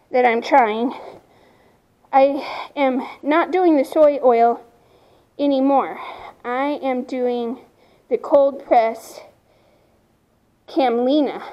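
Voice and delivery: ordinary delivery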